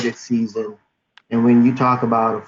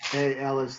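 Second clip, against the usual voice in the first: muffled; 3 semitones higher, 7 dB quieter than usual. Real, not acted. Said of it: "sorry, I was a little muffled".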